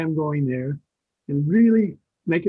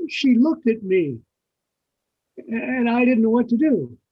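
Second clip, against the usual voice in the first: put-on flustered young actor; 5 semitones higher, 3 dB louder than usual.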